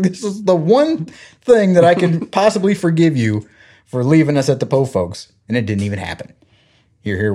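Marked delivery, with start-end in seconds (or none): 0.0-5.3 higher, laughing reproach; playful child grudge; mock scolding. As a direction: scold them, barely holding laughter.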